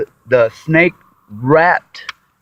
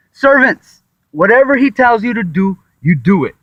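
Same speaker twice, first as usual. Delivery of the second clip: commanding call; 7 semitones higher, 4 dB louder than usual. Retold or imitated. Mary orders the servants.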